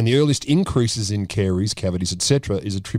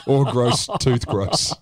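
laughing while talking; 3 semitones higher, slower than usual. Real, not acted. Laughing at gross topic.

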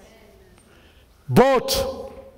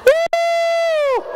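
very high shouted woo; joyful whoop. Real, not acted. Preacher whooping with joy to congregation.

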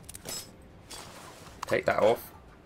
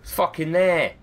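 exasperated discovery; 7 semitones higher, 5 dB louder than usual. Real, not acted. Finally spots the alternator.

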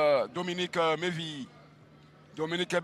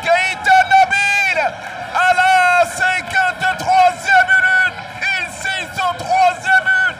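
drawn-out, high, loud goal call; excited sports commentary; 5 semitones higher, 10 dB louder than usual. Real, not acted. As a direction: roar the scorer's name, stretching it.

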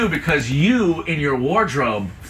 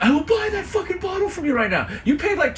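mock outraged buyer; 13 semitones higher than usual. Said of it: "Sam was like".

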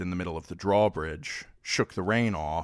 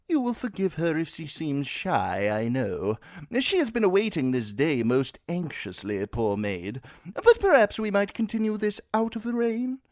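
higher, smooth courtly voice; a young noble full of smooth excuses, raised pitch; courteous Frey heir; 11 semitones higher than usual. Acted. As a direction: play a polite, eager host lord. Edwin Frey voiced again.

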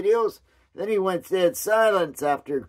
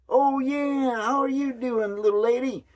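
higher, hammed-up cowboy charm; cowboy accent; 7 semitones higher than usual. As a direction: play the flirty cowboy greeting a lady, pitched up. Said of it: "talking to him like he's a cowboy".

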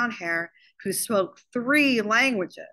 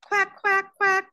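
high, squawking duck quacks; three short, high quacks; 12 semitones higher, 4 dB louder, slower than usual. A narrator's reading. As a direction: imitate a wooden duck call, pitched way up. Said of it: "it quacked like a duck".

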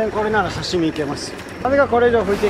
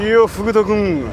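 slow, lightly put-on Anago voice; Anago-san impression; 5 dB louder than usual. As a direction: draw out the words like the cartoon character. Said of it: "マスオさんの声真似".

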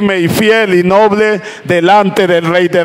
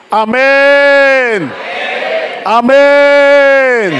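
calling out amen; 6 semitones higher, much slower than usual. Real, not acted. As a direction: throw the amen out to the church. Preacher calls amen.

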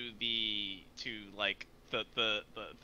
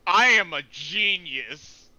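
loud, slow, higher-pitched boast; triumphant boast; 5 semitones higher, 9 dB louder, slower than usual. Real, not acted. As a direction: crow it proudly and loudly. Game master boasts after finding spell.

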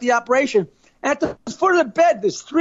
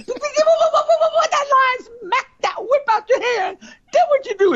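much higher, slow and stretched; high-voiced friend; 12 semitones higher than usual. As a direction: draw the question out up high. Friend asks what next.

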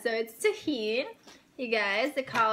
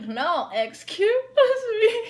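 higher, louder, slow and drawn out through a laugh; mock snooty girl, insisting; 11 semitones higher, 6 dB louder, much slower than usual. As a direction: lean on it like a stuck-up stranger pushing back. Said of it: "And she was like".

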